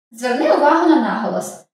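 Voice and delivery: normal talking voice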